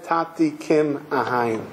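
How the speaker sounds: put-on low, slow recollection of a child's plea; solemn quoted plea. Speaker recalls the child's words to father, solemn and hushed. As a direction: let each word fall slowly and low. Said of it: "when the child said".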